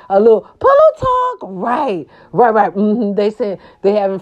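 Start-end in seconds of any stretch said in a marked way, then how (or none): 0.1-1.4 squeaky high-pitched catchphrase; high squeaky singsong. Acted.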